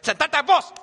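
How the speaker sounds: loud, high-pitched barked order; sharp, exasperated command. Acted. Telling children who don't listen to sit, sharply. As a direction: raise your voice to make them obey. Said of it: "And they don't listen".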